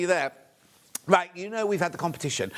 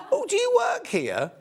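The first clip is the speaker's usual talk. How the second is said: put-on very high voice, innocent question; squeaky mock-innocent enquiry; high-pitched imitation. [name] asking a workmate at the party, very high put-on voice.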